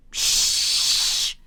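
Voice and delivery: long, loud shush. Acted. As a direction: hush them with a forceful hiss. Hushing a talkative child.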